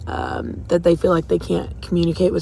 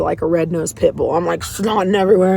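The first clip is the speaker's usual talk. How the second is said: usual speaking voice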